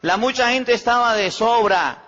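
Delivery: preaching rebuke. Acted. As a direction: drive the repeated phrase home to the congregation.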